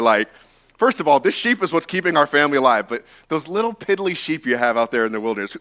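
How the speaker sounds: ordinary talk, nothing marked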